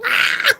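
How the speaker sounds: very high loud squeal; delighted squeal. Real, not acted. Squeal at the cat.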